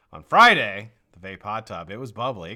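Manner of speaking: ordinary delivery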